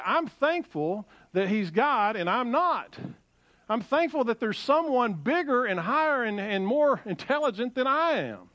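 ordinary talk

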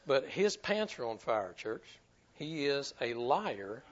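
usual speaking voice

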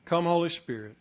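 softly invoke, trailing down to a low amen; prayer. Real, not acted. Preacher asking the Holy Spirit to transform hearts, praying quietly.

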